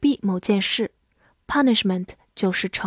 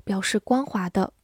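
normal talking voice

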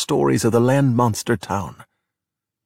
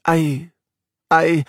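stammering; 5 semitones higher than usual. A narrator's reading. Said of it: "Luca stammered".

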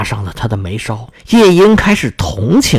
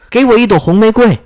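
high, raised-voice plea; calling out loud; 7 semitones higher, 4 dB louder than usual. A narrator's reading. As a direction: call out to the tree, pitched up. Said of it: "夜莺高声喊道".

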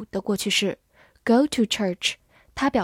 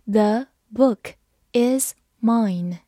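slow reading; much slower than usual. Shown as a demonstration. Teacher reading slowly for students. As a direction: split into two slow chunks. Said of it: "好，慢慢来读。".

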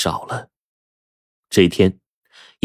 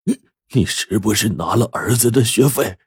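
higher-pitched, strained accusing question; mildly acted harsh questioning, stumbling at the start; stern demand; 4 semitones higher than usual. A narrator's reading. Sick husband suspects wife took son's tuition, stern. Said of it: "厉声问道".

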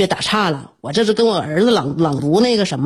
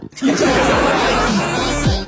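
laughing; 5 semitones higher than usual. Real, not acted. Laughs after the poem.

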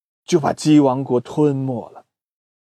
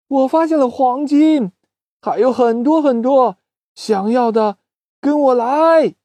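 eager call; 8 semitones higher than usual. Acted. Doudou luring the chickens away, high and excited. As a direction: lure the crowd to follow.

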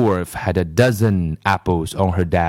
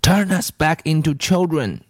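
put-on a firm, higher demand from a boy; insist, leave no room to refuse; 7 semitones higher than usual. A narrator's reading. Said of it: "Todd demanded".

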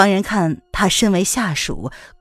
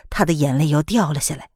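ordinary delivery, nothing marked

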